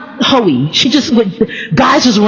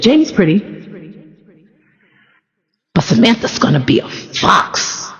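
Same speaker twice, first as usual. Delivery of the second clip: imitating grandma; 3 semitones lower, 3 dB quieter than usual. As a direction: let the fox part sink low and hushed. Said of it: "I say it like that every time".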